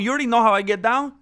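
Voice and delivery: ordinary talk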